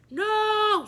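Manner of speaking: high, loud groaning shout; boys protesting. A narrator's reading. Boys protest meeting the new teacher, loud and high. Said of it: "said all the boys".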